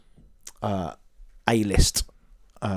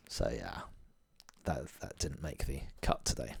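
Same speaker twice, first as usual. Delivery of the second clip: ordinary delivery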